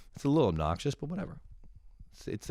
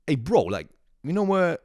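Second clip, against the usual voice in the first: attempted New York street accent, pitched up; 6 semitones higher, 4 dB louder than usual. Stranger asking for directions, rough New York accent attempt. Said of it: "sounded like New York".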